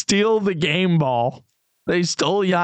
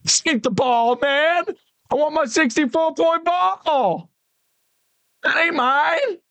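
much higher, squeaky put-on voice, slow at the end; mocking squeaky voice; 11 semitones higher than usual. Pacers player handing Giannis a used ball, squeaky mock voice. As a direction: ham up a whiny high voice. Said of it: "They're like".

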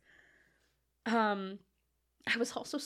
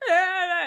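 higher, louder feeble groan; mock groan; 6 semitones higher, 9 dB louder than usual. Acting out the sick woman on the stairs with a mock groan. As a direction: moan like you're collapsed on the stairs.

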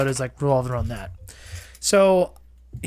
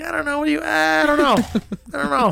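high-pitched mock New Jersey accent; vague New Jersey accent; 10 semitones higher, faster than usual. Friend asks about the accent, vague New Jersey demonstration. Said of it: "New Jersey?".

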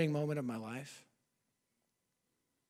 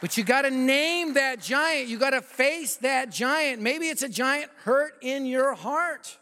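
fervent preaching; 5 semitones higher than usual. Acted. Preacher urging the congregation to name their giant, fervent. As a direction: drive it home to the congregation.

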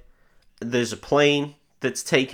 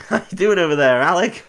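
light shout; mock call out; 7 semitones higher, 5 dB louder than usual. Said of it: "he shouts something like".

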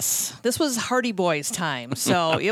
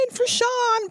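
mock teen scream; 3 dB louder than usual. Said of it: "like, screaming".

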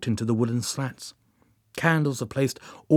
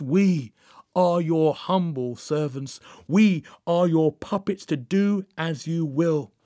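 slow, higher-pitched incantation from a book; slow ritual reading; 5 semitones higher, slower than usual. A narrator's reading. Joan reads to the Master from the book, slow ritual reading. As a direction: read it out slowly as a solemn invocation. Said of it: "started to read slowly from the book".

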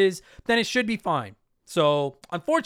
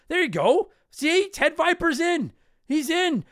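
excited; 10 semitones higher, 3 dB louder, slower than usual. Real, not acted. Cheering a listener's vote.

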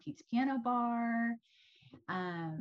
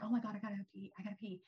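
desperate to pee, half-whispered; urgent, bursting-bladder plea; needing the toilet; 6 dB quieter, much faster than usual. Retold or imitated. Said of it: "And I'm like".

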